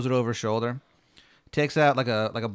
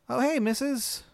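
put-on high-pitched little girl voice; 11 semitones higher than usual. Little girl in red greeting the new neighbor, high voice. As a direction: pitch it way up like a young child. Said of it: "little girl".

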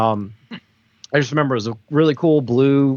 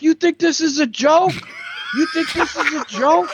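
mock cop shout, much higher and harsher; imitating the cop yelling. Ball buster cop yelling at the kids by the cars, mock shout. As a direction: bellow it like a ball-busting cop tearing into teenagers. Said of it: "this guy yelling at everyone".

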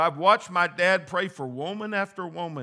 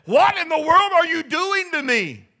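put-on high, loud, desperate child cry; imitating a child's wail; 12 dB louder than usual. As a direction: wail like a child getting a shot. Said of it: "what is that child really saying".

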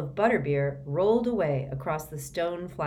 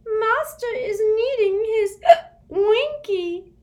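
high, loud, slow sobbing elf; whimpering elf; 10 dB louder, slower than usual. Acted. Winky crying for her master, whimpering. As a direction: sob it like a heartbroken little elf. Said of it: "whimpered the elf".

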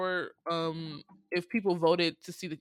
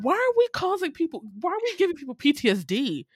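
higher, louder exasperated protest; exasperated outrage; 7 semitones higher, 4 dB louder than usual. Retold or imitated. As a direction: demand an answer, fed up and high. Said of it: "And I said".

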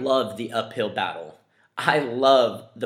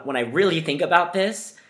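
ordinary delivery

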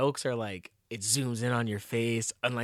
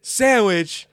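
put-on high, loud taunting kid; hammed-up kid humiliating his sibling; imitating a taunting kid; mocking kid voice; 4 semitones higher, 8 dB louder, slower than usual. Kid mocking other kid on camera.

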